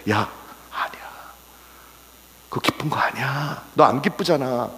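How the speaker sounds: hushed, near-whispered correction at first; understated, soft admonishment; quiet inner reproof. Acted. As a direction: softly set someone straight, as an inner voice. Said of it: "성령께서 그렇게 저한테".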